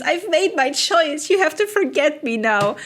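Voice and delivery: lightly voiced melodrama; mock dramatic farewell. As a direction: heroine telling her lover to forget her.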